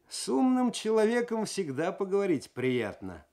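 a suspect cheekily mocking the detective; cheeky. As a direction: toss it off with brazen insolence. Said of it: "развязно ответил Косой".

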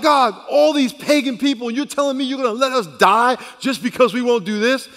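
loud, high-pitched incredulous complaint; protesting to God. Acted. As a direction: raise the voice and plead your case. Acts three men protesting to God.

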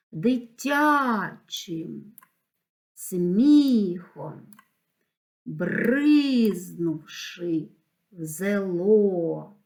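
very slow, long stressed тя, смі, бри, ло in "Дитячим сміхом, бризнувши в зело"; demonstrating the stress. Shown as a demonstration. Teacher marking stresses, "Дитячим сміхом, бризнувши в зело" with each stressed vowel drawn out. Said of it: "Треба розставити наголоси".

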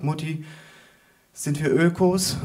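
hint of a wondering child; little boy's question. Small boy quizzing his mother on the tram, childlike question. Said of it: "ein kleiner Junge".

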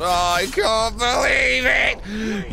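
slow, drawn-out cry of disbelief; exasperated wail. Real, not acted. Exasperated reaction to game.

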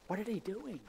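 quiet puzzled driver; muttered disbelief. Retold or imitated. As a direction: mutter it to yourself in the car. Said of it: "you're like".

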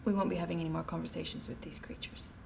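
cold quiet whisper. Acted. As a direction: hiss it privately to the constable. Official whispers to constable.

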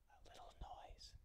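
tiny voiceless whisper. Acted. Little rabbit repeats the groundhog's advice, whispering. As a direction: whisper it as small as possible. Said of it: "whispered the rabbit".

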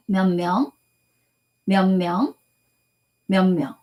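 몇 명 said clearly as myeon myeong, the final consonants nasalized; pronunciation drill. Shown as a demonstration. Teacher drilling students on 몇 명, carefully articulated as myeon myeong. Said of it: "몇 명発音三回いきます".

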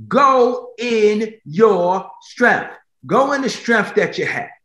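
high, drawn-out preacher's cry; preaching climax. Acted. Preacher urging the church to go, high and slow. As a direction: stretch every word out to the crowd.

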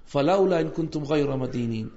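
fast, flowing recitation of a verse; Quran recitation. Acted. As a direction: recite it smoothly, devoutly.

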